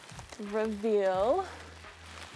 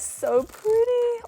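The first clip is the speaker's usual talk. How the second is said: delighted; 12 semitones higher, 6 dB louder, slower than usual. Real, not acted. Opening the little extras.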